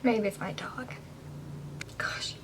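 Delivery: hushed and unsure; whisper. Real, not acted. Wondering about the noise on the stairs, whispering. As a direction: keep it to a breath, guessing at the noise.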